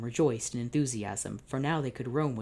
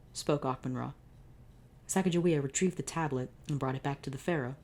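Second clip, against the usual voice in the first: plain reading voice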